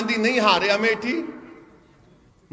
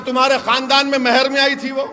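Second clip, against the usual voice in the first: raised, driving orator's voice from a stage; fiery rally speech; 4 semitones higher, 8 dB louder than usual. Acted. Politician rousing a rally crowd, fiery full-force oratory. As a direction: hammer each line home to the crowd.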